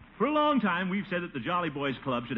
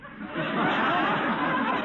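a high breathy chuckle; amused laugh. Acted. To Peavy, amused high chuckle. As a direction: laugh off the druggist's joke.

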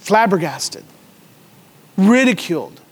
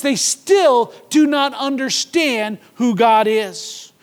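normal talking voice

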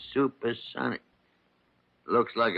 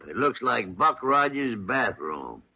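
ordinary talk

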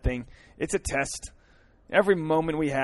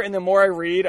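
usual speaking voice

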